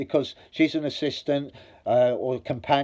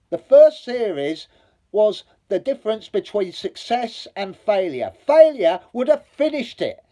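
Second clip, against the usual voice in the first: rising, louder, insistent argument; heated rant; 9 semitones higher, 5 dB louder than usual. Real, not acted. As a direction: hammer the point home, getting worked up. Heated rant about the BBC.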